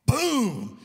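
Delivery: very high, very loud impact noise; explosive boom. Retold or imitated. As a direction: burst out with a sudden boom. Dad's sudden kick to the bed.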